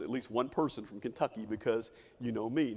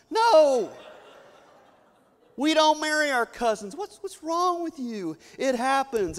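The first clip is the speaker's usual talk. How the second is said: loud, much higher hammed-up protest with a laugh; mock-indignant shout; 9 semitones higher, 7 dB louder than usual. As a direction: act outraged for the laugh, slowing on the last question. Joking indignant reply to outsiders.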